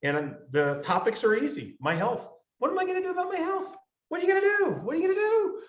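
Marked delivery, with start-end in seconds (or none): 2.6-5.6 high, fast nagging voice, hammed-up; mock nagging voice. Coach nagging listeners about their health, high mock nag. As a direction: pester them like a nagging parent.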